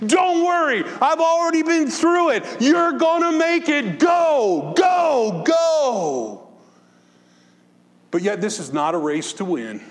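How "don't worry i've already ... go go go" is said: shouting from the finish line. Retold or imitated. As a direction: yell to the runners to come home. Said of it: "waving his arms at us from the finish line".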